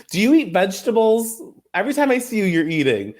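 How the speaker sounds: sweet-then-savage dig, laughing through it; high teasing impression of Mary needling Monica; mock Mary jab. Continues Mary's jab at Monica.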